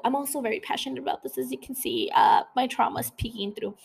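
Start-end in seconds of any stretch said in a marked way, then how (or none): none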